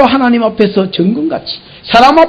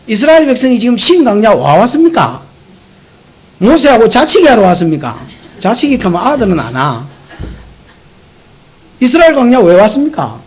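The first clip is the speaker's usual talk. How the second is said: normal talking voice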